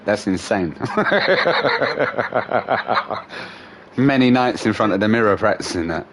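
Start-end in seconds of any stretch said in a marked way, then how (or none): 0.8-3.5 long descending chuckle; amused laughter. Real, not acted. Laughing at a mate's practiced joke, long amused laugh. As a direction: let out a long rattling laugh that tails down.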